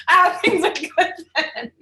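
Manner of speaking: high, loud, giggling relief; laughing. Retold or imitated. Quoting her own relieved answer to the coach, laughing. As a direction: laugh through the punchline.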